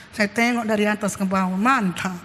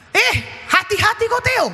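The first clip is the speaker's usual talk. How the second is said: higher-pitched, sudden caution to a son; mock parental warning; 11 semitones higher than usual. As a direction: cut in like an alarmed parent. Voices own warning to son. (menegur anak dengan waspada)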